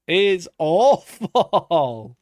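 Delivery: loud dragged-out groan of disgust; drawn-out exclaim. Real, not acted. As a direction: wail the word out in disgust. Same verdict, now drawn out.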